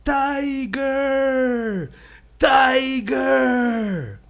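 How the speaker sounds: high, long-drawn call; high-pitched holler. Acted. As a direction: call into the distance, stretching both syllables. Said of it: "the rabbit is calling".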